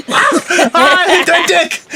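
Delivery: high-pitched play-acted ouch; hammed-up cry at getting hit; mock pained yelp. Host pretending to be shot by a BB gun, mock yelping. Acts out being shot under table.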